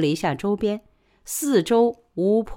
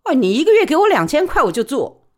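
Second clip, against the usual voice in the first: higher, louder, quick working woman; brisk high-pitched working woman pleading her case; Li Baoli's voice; 9 semitones higher, 6 dB louder, faster than usual. Acted. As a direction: press her case fast and eager. Li Baoli answers boss.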